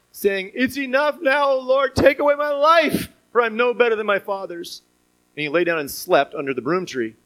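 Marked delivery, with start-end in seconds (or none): 0.6-4.8 high, strained cry of despair; a worn-out man begging to die; despairing plea. Acted. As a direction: plead to God to end it. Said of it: "he asked that he might die".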